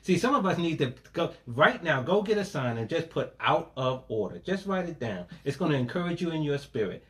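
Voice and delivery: usual speaking voice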